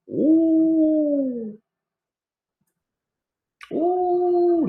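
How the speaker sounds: high sung-out ooh of mock surprise; high falsetto ooh. Real, not acted. Reacting to the book's claim with a high falsetto ooh. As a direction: let out a long, high ooh.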